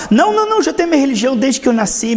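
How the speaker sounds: high-pitched rapid protest; hammed-up refusal, pitch shooting up then easing; mock dismissal. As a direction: brush it off in a high, hurried voice. Acted believer refusing the gospel.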